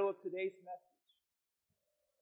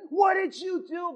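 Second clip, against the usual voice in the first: higher, sharp accusing question; accusing wife; 8 semitones higher, 5 dB louder than usual. Acted. Wife confronts husband over mushrooms, accusing. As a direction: demand it from the husband.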